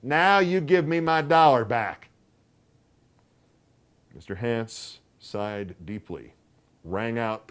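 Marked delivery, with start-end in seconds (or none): 0.0-2.1 high, loud aunt voice, triumphant; triumphant demand. A narrator's reading. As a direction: demand it, sure she's right. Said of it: "her eyes flashing triumph".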